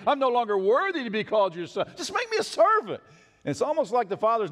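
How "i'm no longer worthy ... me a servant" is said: higher, begging the father; pleading son. Pretend. Prodigal son begging his father, higher and pleading. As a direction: cry out as a broken son.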